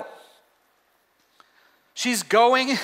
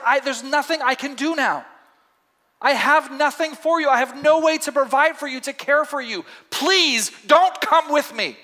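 higher, heavy-hearted plea, slowing at the end; despairing Naomi; 6 semitones higher than usual. Acted. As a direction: Naomi with nothing left to give, begging. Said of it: "but she's like".

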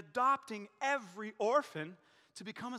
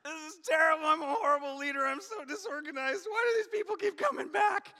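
high loud whiny lament, a touch of fake crying; hammed-up complaining about leadership; wailing self-pity, higher and louder; mock self-pity; 6 semitones higher, 6 dB louder than usual. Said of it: "I'll be like".

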